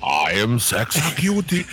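imitating a vague European accent; hammed-up foreign-accented song quote. Host quotes the Sexecutioner song, hammy European accent. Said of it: "What accent is [name] using".

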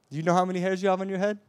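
urgent, speeded-up repeat; rapid pressing question. Shown as a demonstration. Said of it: "question asked twice".

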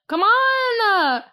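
high, drawn-out whining "come on"; exasperated plea. Real, not acted. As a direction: stretch it out in a high, pleading wail.

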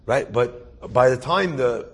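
ordinary delivery

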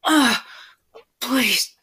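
loud, high, pleading cries; impassioned, pleading outcry; screaming with pleasure. Acted. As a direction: cry out high and loud, begging between moans. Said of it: "but now screaming with pleasure".

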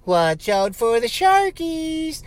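high, slow teasing voice; mock sing-song taunt. Boatman teasing the host stranded at sea in a high slow taunt. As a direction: taunt him like a cheerful bully.

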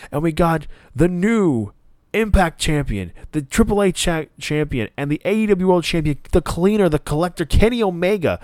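lightly voiced mock Don Callis bragging; Don Callis hype. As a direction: puff up and present the champions like a proud manager.

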